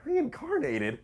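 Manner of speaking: incredulous. Acted. Incredulous echo of the word.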